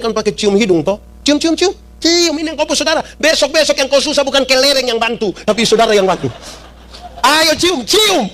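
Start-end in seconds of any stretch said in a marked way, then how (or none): 1.2-8.3 much higher, playful urging to kiss noses; father making fighting sons make peace, high and cheerful; coaxing NTT father. Retold or imitated. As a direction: egg the boys on like a cheerful NTT dad. NTT father making boys reconcile.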